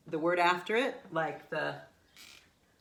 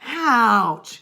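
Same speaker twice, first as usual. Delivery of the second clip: drawn-out, loud and high mock ouch; delayed reaction; 6 semitones higher, 12 dB louder than usual. Shown as a demonstration. Teacher mocking pain felt seconds after hitting a wall, drawn-out yell. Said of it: "it doesn't happen like that five".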